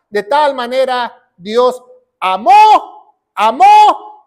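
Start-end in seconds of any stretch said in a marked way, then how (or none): 2.2-3.2 AMÓ con la O final alargada y fuerte; acento en la O. Shown as a demonstration. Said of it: "con acento en la O". 3.3-4.3 AMÓ con la O aguda y fuerte; acento en la O. Shown as a demonstration. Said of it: "con acento en la O".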